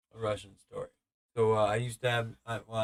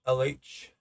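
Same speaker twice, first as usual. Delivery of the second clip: ordinary delivery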